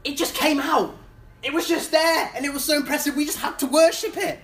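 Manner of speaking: sheepish excuse. Retold or imitated. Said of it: "what does Aaron say".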